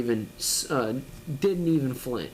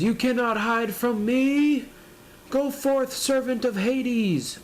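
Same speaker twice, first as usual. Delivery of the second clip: much higher, slow, grandly menacing villain; 9 semitones higher, slower than usual. Acted. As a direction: play the old villain summoning his servant, high and slow. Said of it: "He says".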